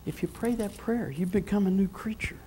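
sudden hush, almost secret; hushed whisper. Acted. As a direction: whisper it softly, drawing the church in close. Preacher drops to intimate hush.

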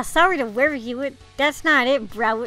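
normal talking voice

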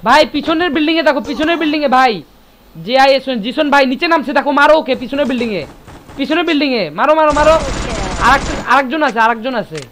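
loud urgent callouts; excited shouting. Real, not acted. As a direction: yell directions at your squad.

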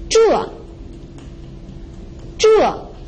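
zhuo starts high and drops sharply; falling tone. Shown as a demonstration. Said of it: "The fourth tone is a falling tone".

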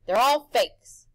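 Harvey calls the gifts fakes, slowly; firm slow dismissal. A narrator's reading. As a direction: spell out his verdict. Harvey refuses.

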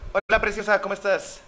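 high sweet cooing voice. Real, not acted. Greeting a creature on screen.

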